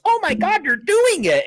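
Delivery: high, loud, thrilled shriek; excited squeal. Retold or imitated. Telling how Shelly shrieked as the door moved, thrilled. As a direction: squeal with amazed delight.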